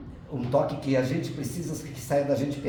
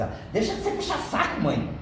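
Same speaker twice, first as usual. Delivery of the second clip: mocking wife; 9 semitones higher than usual. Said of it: "a minha sogra ficava assim".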